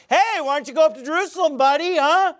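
high, loud, quick taunt; mocking. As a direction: goad him with fake-friendly egging on. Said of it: "making fun of him, mocking him".

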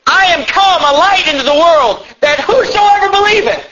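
high-pitched shouted proclamation; shouting. Acted. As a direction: cry the verse out like a great preacher. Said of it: "Jesus have shouted these words".

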